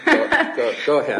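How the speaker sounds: loud laugh through the words. Real, not acted. Judge telling apologetic counsel to go ahead, laughing. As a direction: wave it on with a hearty laugh.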